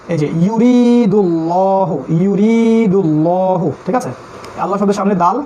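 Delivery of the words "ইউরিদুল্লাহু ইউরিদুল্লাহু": ইউরিদুল্লাহু very slow, with a heavy ল. Shown as a demonstration. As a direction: draw out ইউরিদুল্লাহু with a full, dark ল. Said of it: "তার মধ্যে পেশ".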